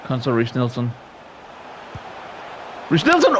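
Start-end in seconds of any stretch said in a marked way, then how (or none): 2.9-3.4 excited shout. Real, not acted. His player scores.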